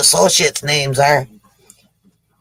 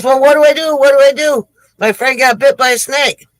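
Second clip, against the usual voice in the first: high-pitched, loud fretting; mock panic; 8 semitones higher, 4 dB louder than usual. Gold digger to doctor about his bitten friend, mock panic. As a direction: play a frantic friend begging advice.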